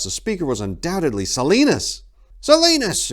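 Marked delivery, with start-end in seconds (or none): 2.4-3.1 high loud cry of recognition; monk recognizing a lost friend; surprised exclamation. A narrator's reading. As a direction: shout the name in surprise, higher. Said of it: "exclaimed Jodithus".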